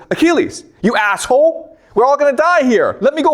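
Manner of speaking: a frantic friend who wants to fight; agitated Patroclus. Retold or imitated. Patroclus yells at Achilles.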